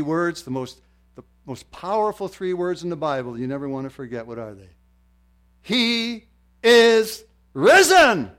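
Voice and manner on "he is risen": loud, high, very slow shout; triumphant proclamation. Real, not acted. Preacher answering with the most powerful words, triumphant shout. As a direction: proclaim each word separately, huge and slow.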